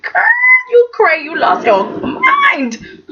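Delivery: high-pitched incredulous cry, drawn out at the end; playful "you've gone crazy" wail; mock outburst. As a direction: exclaim it with amused disbelief.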